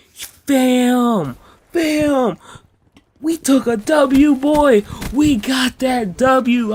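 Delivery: loud, high hyped yelling; high, loud victory whoop; hyped shout. Retold or imitated. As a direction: celebrate like you just won big. Hyped shout after door opens.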